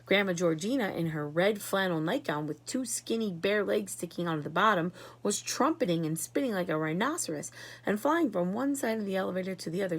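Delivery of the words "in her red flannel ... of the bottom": ordinary delivery, nothing marked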